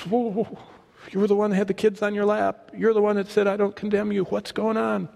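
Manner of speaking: high, startled protest; shocked disciple. Retold or imitated. John protests to Jesus, shocked.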